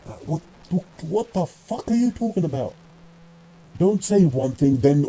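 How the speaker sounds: veel hoger, ongelovig uitroepend; incredulous outburst. Real, not acted. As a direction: echt verbijsterd: waar heb je het over. Reacting to the mocked quote.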